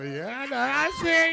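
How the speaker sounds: normal talking voice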